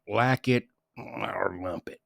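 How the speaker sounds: slow whispered catchphrase. Retold or imitated. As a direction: breathe it out like a toy recording. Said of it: "it would say".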